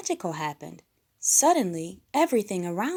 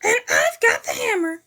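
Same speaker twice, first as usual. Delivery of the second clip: loud high shout; 10 semitones higher, 8 dB louder than usual. A narrator's reading. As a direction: shout it proudly. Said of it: "shouted Gonzo the Kid".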